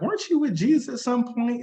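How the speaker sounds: high-pitched, mock suspicious question; pointed questioning. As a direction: play the maid pointedly asking, voice jumping up. Acted maid questioning Peter.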